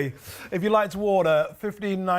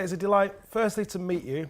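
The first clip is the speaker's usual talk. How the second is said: ordinary talk, nothing marked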